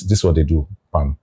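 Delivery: usual speaking voice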